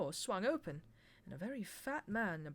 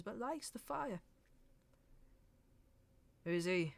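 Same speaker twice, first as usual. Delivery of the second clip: higher, scornful pub landlord; grumbling landlord; 7 semitones higher, 4 dB louder than usual. A narrator's reading. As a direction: sneer it like a sour publican. Narrator voices the landlord.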